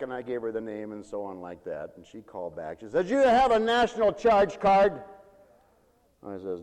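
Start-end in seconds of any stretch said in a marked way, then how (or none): 3.1-5.0 harsh clerk. Retold or imitated. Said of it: "She was very harsh".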